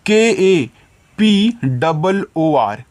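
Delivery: slow, letter by letter; spelling letters out. Shown as a demonstration. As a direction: spell each letter slowly for the children. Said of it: "teacher spells letters for children".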